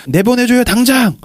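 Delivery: high-pitched, acted frantic demand; angry demand. Tao locked in by Walt, angrily demanding release. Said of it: "막 화를 막 내요".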